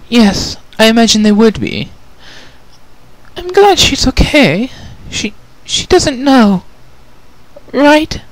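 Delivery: high, gentle refined lady, a touch sad; Rarity, sad. Acted. Rarity asks about her sister, gently sad.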